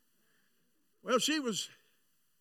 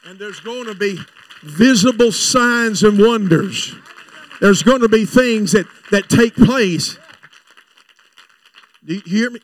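full-force preaching; 2 semitones lower, 21 dB louder than usual. Acted. Preacher promising miracles at the revival, thundering. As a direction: proclaim every word.

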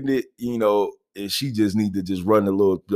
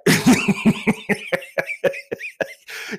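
long, high real laugh; 14 semitones higher than usual. Real, not acted. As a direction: break into helpless laughter mid-story. Laughs at own story.

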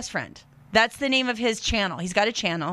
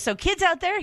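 put-on high lightly Serbian-accented call to kids; a light Serbian accent, pitched up; 7 semitones higher than usual. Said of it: "I'm doing the accent".

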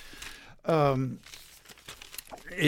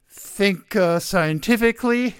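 ordinary delivery

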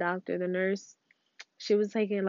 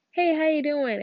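higher, imitated passing hello; casual greeting; 7 semitones higher, 4 dB louder than usual. People asking without really caring, imitated casual greeting. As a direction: toss out a higher-pitched mock casual hello. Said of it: "that's just their way of saying, like, "Hi".

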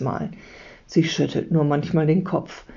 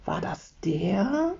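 slow, hushed question; soft, slow storybook whisper; 2 semitones higher, 5 dB quieter, slower than usual. A narrator's reading. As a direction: ask it secretly, soft and drawn out. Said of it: "flüstert Leo".

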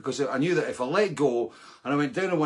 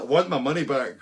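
mock angry demand; 6 dB louder than usual. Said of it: "I was raging at these guys".

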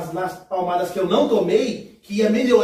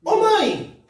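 high call to a mother; 11 semitones higher, 3 dB louder than usual. Retold or imitated. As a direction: call out to get her attention. Calling out to careless mother.

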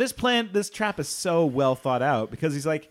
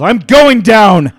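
shouting; 5 semitones higher, 19 dB louder, much slower than usual. Acted. Tobias diving in, shouting.

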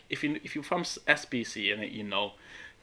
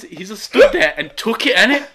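laughing; 6 semitones higher, 8 dB louder than usual. Real, not acted. Laughing while telling.

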